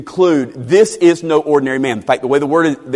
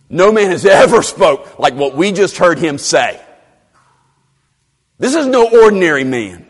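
raised, louder insistence; higher, punchy proclamation; emphatic testimony; 5 semitones higher, 6 dB louder than usual. Acted. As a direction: declare it forcefully, as an astonished witness. Officers' awed answer restated forcefully.

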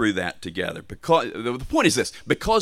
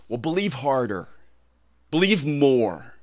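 insistent demand; 4 semitones higher, 4 dB louder than usual. Acted. Preacher voicing a theology that burdens struggling believers, insistent. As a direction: press harder on the second demand, higher and louder.

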